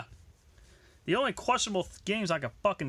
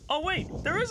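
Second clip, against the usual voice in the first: sudden realization; 12 semitones higher than usual. Real, not acted. Suddenly remembering a game.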